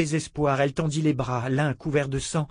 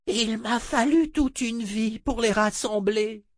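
desperate sorceress voice; 10 semitones higher, slower than usual. Acted. Adi protests while struggling.

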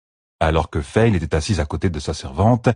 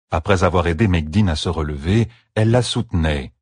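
plain reading voice

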